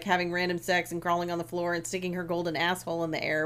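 ordinary talk, nothing marked

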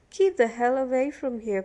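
loud, hostile order; irate prisoner keeping someone at bay; angry warning. A narrator's reading. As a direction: warn him off forcefully. Prisoner warns Billy off.

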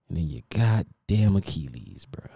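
hushed whisper. Real, not acted. To A-Rod about his torn Achilles, a hushed whisper. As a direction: breathe it out under your breath. Sad aside about injury.

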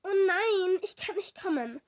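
high, loud dismay, easing off; sudden cry. A narrator's reading. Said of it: "rief plötzlich". (plötzlicher, bestürzter Ausruf)

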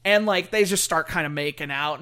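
ordinary talk

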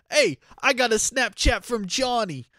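hammed-up Jersey gangster voice; bad New York accent. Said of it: "terrible Italian slash New York accent".

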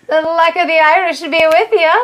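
high, lilting attempt at an Irish accent. Podcast host greeting listeners on Saint Patrick's Day, attempted Irish accent. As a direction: put on a playful, amateur Irish brogue. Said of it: "I can't do accents".

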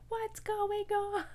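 replaying her own panicked cry on the table, high and slow; retold yell. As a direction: stretch it out high and slow as a half-joking yell. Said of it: "yelled really loud".

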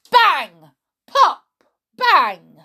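loud mock bangs and pops; popping sounds. A narrator's reading. Narrator voicing the balloons bursting, loud bangs.

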